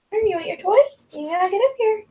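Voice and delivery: high sing-song pet talk offering a toy; coaxing with toy. Real, not acted. Offering the dog his toy, high coaxing pet voice. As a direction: tempt him up, high and bright.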